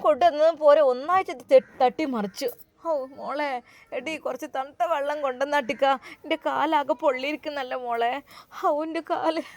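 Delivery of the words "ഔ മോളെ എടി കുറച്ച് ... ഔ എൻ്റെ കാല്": pained moaning. Acted. Scalded grandmother moaning softly in pain. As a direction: moan it out like the burn stings. Grandmother begs for cold water.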